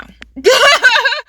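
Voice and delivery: high, loud burst of laughter. Real, not acted. Laugh after telling story.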